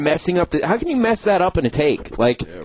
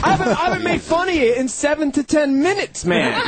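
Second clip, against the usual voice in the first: higher-pitched indignant retort; indignant protest; 8 semitones higher than usual. Retold or imitated. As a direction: replay a defensive protest, pitched up. Retelling his protest in an argument.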